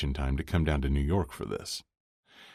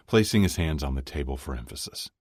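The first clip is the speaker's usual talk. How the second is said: plain reading voice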